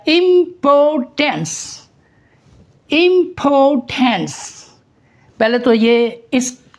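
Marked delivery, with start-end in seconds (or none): 0.1-4.6 "importance" split into slow syllables, im-por-tens. Shown as a demonstration. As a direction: break "importance" into syllables slowly. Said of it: "इसके आप syllable बनाएं". ("Importance" धीरे, syllable तोड़कर)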